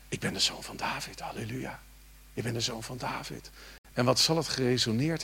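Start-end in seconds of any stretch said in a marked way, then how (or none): 0.1-3.5 hushed, whispered confession. Acted. Preacher voicing the blind man's words to Jesus, whispered. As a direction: breathe the words almost soundlessly.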